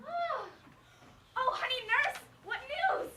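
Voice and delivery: high, eager plea; eager pleading. Acted. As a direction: beg for the news. Juliet eager for news.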